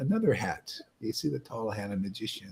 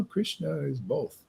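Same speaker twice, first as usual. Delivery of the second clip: normal talking voice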